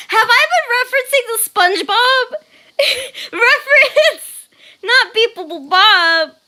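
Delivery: loud, high question broken by laughter; high-pitched amused disbelief; laughing disbelief. Real, not acted. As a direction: ask it incredulously through giggles. Amused surprise at viewer's question.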